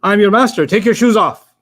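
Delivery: forceful declaration, each phrase landing firmly; divine command. Acted. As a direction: proclaim it as a command from God. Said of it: "This is what Allah said to Musa".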